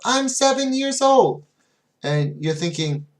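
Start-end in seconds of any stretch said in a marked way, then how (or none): 0.1-1.4 put-on high, loud little kid reply; a hint of a small child answering brightly; mock child answer. Teacher imitating a young student's wrong answer, high and loud.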